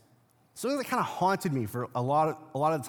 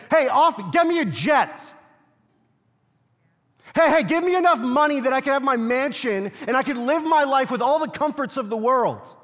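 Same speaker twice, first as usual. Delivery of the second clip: mock shout of a greedy stage pastor, higher and louder; 9 semitones higher, 8 dB louder than usual. Preacher mocking greedy pastors for his congregation, hammed-up shout. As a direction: lampoon a pastor demanding a jet. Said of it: "pastors are standing on stages and saying".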